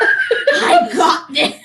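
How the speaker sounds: high, slow squealing laugh. Real, not acted.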